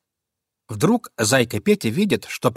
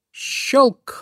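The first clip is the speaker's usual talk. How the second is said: high sharp snapping click; trap click; 12 semitones higher than usual. A narrator's reading. Trap snaps on the bunny's paw, a sharp click. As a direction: snap it like a trap closing.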